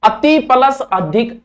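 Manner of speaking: ordinary talk, nothing marked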